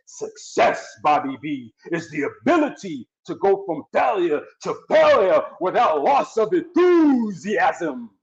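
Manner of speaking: lightly voiced professor, slowing on the last line; a hint of the professor drawing out the motto; professor's voice. Quoting the professor to a struggling brother, lightly voiced mentor. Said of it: "you hear the professor says".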